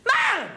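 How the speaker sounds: MUM as one short, piercingly high shout. Shown as a demonstration. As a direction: cut MUM short in a high panicked yell. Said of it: "Very short".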